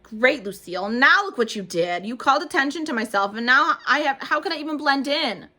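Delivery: loud, slowed accusation; exasperated girl. A narrator's reading. Junie scolding Lucille for drawing attention, loud. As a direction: blame her, fed up.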